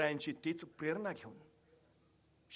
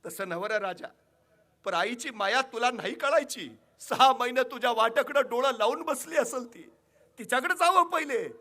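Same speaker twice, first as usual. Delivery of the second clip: building louder and higher rustic mother; pleading mother; 8 semitones higher, 10 dB louder than usual. Acted. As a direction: press him to go to his own mother. Mother scolds the king lovingly. (आईची वाढती कळकळ)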